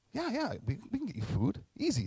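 high, casual easy yes; breezy yes. Retold or imitated. To the caller, an offhand high breezy promise. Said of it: "I'm like".

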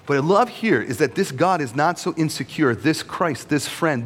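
normal talking voice

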